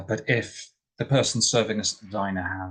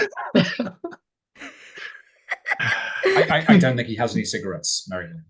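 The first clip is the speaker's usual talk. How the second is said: laughing through the words; chuckling as you protest he has none; 3 semitones higher, 4 dB louder than usual. Real, not acted. Laughing while answering.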